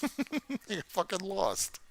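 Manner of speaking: laughing it off in disbelief. Real, not acted. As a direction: laugh through the line. Repeated with laugh.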